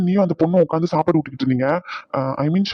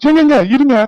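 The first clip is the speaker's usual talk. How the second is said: much higher and louder, rushing to stop her; urgent shout; 9 semitones higher, 8 dB louder than usual. Acted. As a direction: cry out to halt the slap. Stopping someone hitting child. (குழந்தையை அடிப்பதை தடுக்கும் அவசரக் கூச்சல்)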